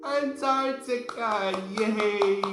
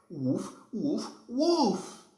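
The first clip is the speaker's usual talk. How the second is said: dog barking; 4 semitones higher than usual. Pretend. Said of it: "It says".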